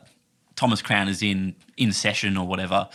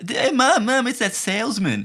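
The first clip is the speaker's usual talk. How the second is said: put-on high excited kid's voice; child voice; 13 semitones higher than usual. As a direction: tug at mum like a small kid. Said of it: "he's like".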